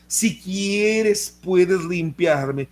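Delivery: "si quieres" dicho lento y vacilante; súplica dudosa. Shown as a demonstration. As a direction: arrastra "puedes limpiarme" con duda. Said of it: "Escuche nada más". (ruego lento y dudoso)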